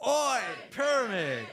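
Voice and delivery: loud, slow crowd yell; crowd shout. Real, not acted. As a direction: shout it back at the band together. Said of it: "let's just try this out".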